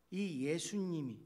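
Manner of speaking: usual speaking voice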